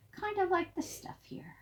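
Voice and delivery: high soft whisper; whispered aside. Real, not acted. Aside while showing picture.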